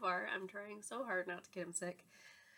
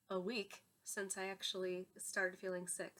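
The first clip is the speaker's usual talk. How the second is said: normal talking voice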